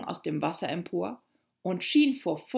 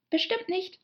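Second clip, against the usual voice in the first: high, eager promise; hasty assurance; 13 semitones higher than usual. A narrator's reading. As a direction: reassure at once, anxious to change the subject. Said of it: "sagte Alice in großer Eile". (eilig beteuernd, mit hoher Stimme)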